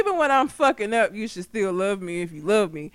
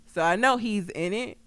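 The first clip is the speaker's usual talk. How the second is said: ordinary talk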